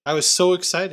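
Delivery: ordinary delivery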